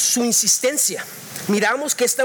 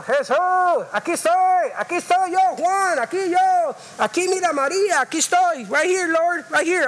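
high, pleading call to Jesús; desperate crying out; 4 semitones higher than usual. Retold or imitated. Preacher voices woman crying out to Jesus, pleading call. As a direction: shout for attention, raised and pleading. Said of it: "Estaba diciendo".

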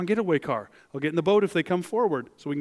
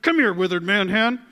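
snotty Jesus; 5 semitones higher, 6 dB louder than usual. Retold or imitated. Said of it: "Maybe he's snotty at this point".

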